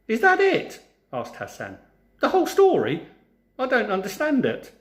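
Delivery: can't believe the story is over; baffled disbelief. A narrator's reading. Hassan protesting to the giant that the story ended, incredulous.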